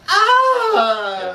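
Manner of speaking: high squealing yelp; a sudden high shocked "Ah!". Retold or imitated. Mock shocked cry of soft people.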